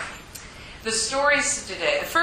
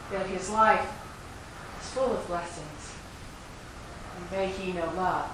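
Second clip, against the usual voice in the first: compassionate. Acted. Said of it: "in all compassion and love".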